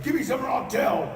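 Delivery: put-on high, loud kid's threat; threatening demand. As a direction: shout it as blackmail, pushy. Child threatens uncle, retold.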